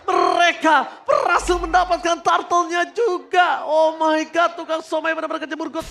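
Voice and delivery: high, loud hype caster scream; fighting game announcer. Acted. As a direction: scream the double kill call. Climax of esports play-by-play.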